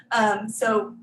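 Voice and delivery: normal talking voice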